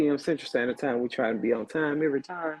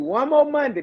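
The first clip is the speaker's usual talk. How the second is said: cheering lift; 10 semitones higher, 4 dB louder than usual. Acted. Host cheering listeners toward weekend.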